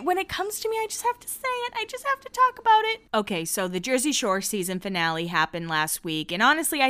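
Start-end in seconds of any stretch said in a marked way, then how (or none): 0.0-3.0 very high, light sing-song; playful sing-song. Pretend. Podcaster excusing her rant to listeners, in a high playful sing-song. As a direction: lilt it way up high and quick.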